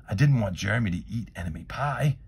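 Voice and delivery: ordinary delivery, nothing marked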